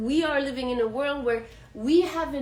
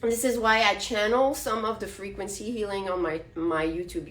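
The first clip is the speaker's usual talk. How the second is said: ordinary talk, nothing marked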